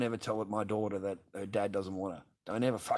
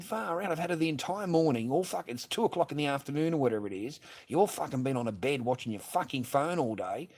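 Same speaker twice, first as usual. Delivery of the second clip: high-pitched, worked-up griping; retold exasperated complaint; 5 semitones higher than usual. Father replaying his complaint about childminding, exasperated. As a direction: replay a fed-up tirade, voice raised in pitch. Retelling his angry argument with her.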